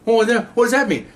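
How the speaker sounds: high, loud retort; mock defiant mother; challenging. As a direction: push back at him.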